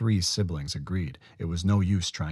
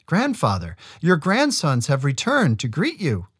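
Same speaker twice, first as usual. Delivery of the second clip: respectful sister; 7 semitones higher, 4 dB louder than usual. A narrator's reading. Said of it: "said Nolka to the Chenu".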